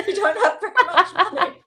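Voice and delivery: fast, loud, high laughing words. Real, not acted. Guest recalls telling her girlfriend to take free meals, laughing. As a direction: giggle through the punchline. Laughs retelling advice to friend.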